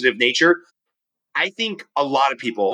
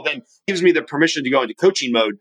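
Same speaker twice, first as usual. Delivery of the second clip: ordinary talk, nothing marked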